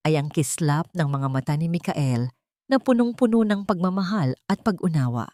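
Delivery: ordinary delivery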